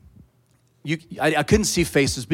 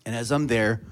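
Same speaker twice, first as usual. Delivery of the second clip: usual speaking voice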